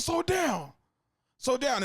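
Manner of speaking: high-pitched, urgent urging; insistent plea. Acted. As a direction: insist on it, voice jumping up. Acted pleading with impatient teammate.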